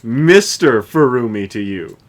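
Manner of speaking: loud slow mock formality; mock haughty. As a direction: correct them with mock pomp.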